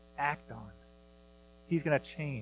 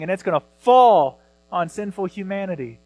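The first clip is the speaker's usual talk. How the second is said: usual speaking voice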